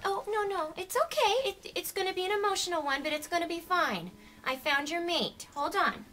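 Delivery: high, sing-song soothing; mock comforting. Comforting a worried shoe, high and soothing.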